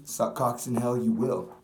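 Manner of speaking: normal talking voice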